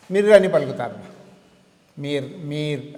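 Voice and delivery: "మీర్" with a sounded final r; non-native pronunciation. Shown as a demonstration. Teacher shows how non-natives say it, r sounded. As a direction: say "మీర్" as spelt, ending on r. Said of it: "అట్లా పలుకుతారు".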